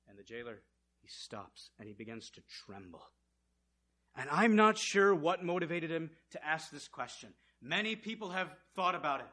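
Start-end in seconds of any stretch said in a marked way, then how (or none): none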